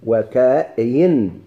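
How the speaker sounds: "وكاين" very slowly, wa-ka-yin; "وكاين" drawn out for students to copy; variant pronunciation. Shown as a demonstration. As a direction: stretch each syllable of "وكاين". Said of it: "كاين لغة".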